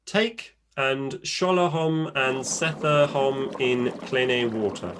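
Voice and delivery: slow, archaic recipe reading; Old English reading. Shown as a demonstration. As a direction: read it like a medieval text. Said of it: "I'll read an original recipe in Old English".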